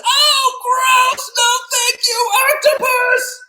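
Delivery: very high, disgusted screech; unhappy boy. Acted. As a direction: squeal the refusal as a horrified child. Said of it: "the boy is not too happy about that".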